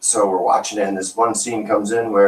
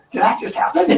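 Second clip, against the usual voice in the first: shocked. Retold or imitated.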